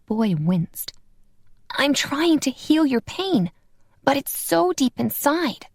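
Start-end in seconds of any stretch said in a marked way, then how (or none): 1.7-5.7 lightly voiced earnest little boy; a small boy, eager to help. A narrator's reading. Tino, child voice.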